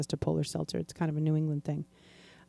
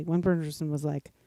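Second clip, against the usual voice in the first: ordinary talk, nothing marked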